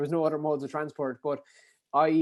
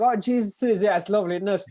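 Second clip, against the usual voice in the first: put-on higher, louder Kerry accent; thick Kerry accent; 6 semitones higher, 7 dB louder than usual. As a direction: play up your own Kerry lilt. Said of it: "probably in a thick Kerry accent".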